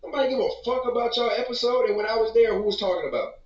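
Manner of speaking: high, loud, exasperated yell; indignant outburst. Real, not acted. Fed up with the podcast host mocking him, indignant shouting. Angry reply to being mocked.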